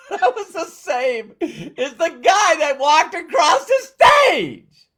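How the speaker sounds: excited laughing shout. Real, not acted. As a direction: burst out laughing and shout it with delight.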